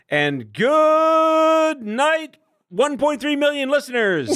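hugely drawn-out, high-pitched good night; hammed-up sign-off. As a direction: stretch it like a showman closing the night.

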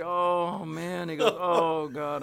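drawn-out groan of dismay; mock despair. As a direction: groan like you're doomed, hammed up. Director despairs, retold for laughs.

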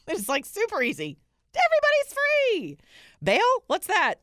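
high, loud mock-cheerful sarcasm; sarcastic mockery. Mocking New York's easy bail for her viewers, shrill sarcasm. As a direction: gush like it's all wonderful to mock it.

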